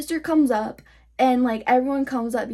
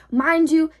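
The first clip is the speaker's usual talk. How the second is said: normal talking voice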